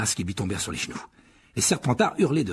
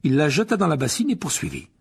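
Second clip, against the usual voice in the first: ordinary delivery, nothing marked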